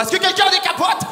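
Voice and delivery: high, loud desperate yell into the street; mock-panicked street shout; frantic shout. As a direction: call out for help in a panic.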